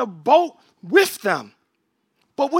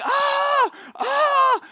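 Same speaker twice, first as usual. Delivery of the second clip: high panicked "ah, ah" cries; frantic cries. Retold or imitated. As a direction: cry out like scared disciples in a storm. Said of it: "and they're frantic".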